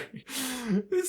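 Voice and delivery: high-pitched amused laugh. Real, not acted. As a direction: break into a high giggle. Mocking laugh at his line.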